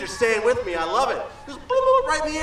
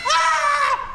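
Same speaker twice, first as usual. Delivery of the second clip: high, loud mock scream of fright; playful hammed-up scream; startled scream; 13 semitones higher, 3 dB louder than usual. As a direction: shriek as the bear looms up. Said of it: "That's what I went".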